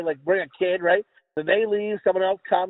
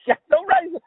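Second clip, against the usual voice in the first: laughing echo; 12 semitones higher, 3 dB louder than usual. Retold or imitated. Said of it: "I was like".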